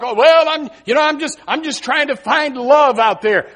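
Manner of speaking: lightly mock a young person's excuse; young people. Preacher imitating young people talking about love, lightly whiny. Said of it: "I'll hear young people talk".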